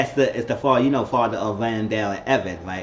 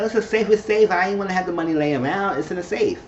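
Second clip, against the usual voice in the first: mock Bella voice; 7 semitones higher than usual. Voicing Bella in retold scene.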